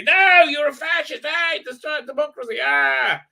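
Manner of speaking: high-pitched hysterical shouting, imitated; hysterical mock screaming. Streamer mimicking people screaming at opponents, hysterical mock screaming. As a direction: screech it like a parody of an outraged mob. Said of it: "people screaming at you, like, hysterically".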